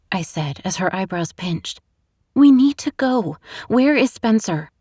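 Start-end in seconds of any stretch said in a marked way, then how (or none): 2.3-4.7 1 urgent. A narrator's reading. Urgent plea to drugged friend.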